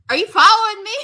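high, loud mock outrage; incredulous challenge. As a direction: throw the question back at him, indignant. Betty confronts the man following her.

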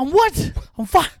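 fired wrestler shouting back, stunned and outraged; shocked outrage. Retold or imitated. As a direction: yell it back in stunned disbelief. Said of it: "He goes".

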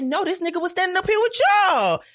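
exasperated. Retold or imitated. Accusing stranger to couple.

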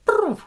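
high, loud rolled "brrr"; robot gunfire noise. Acted. Robot shooting anyone without the right chip, imitated gun burr. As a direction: imitate a robot opening fire. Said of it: "the robot will just go".

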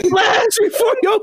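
high crying laugh through the words; shrieking with laughter; laughing shout. Real, not acted. Laughing disbelief at near-death scare.

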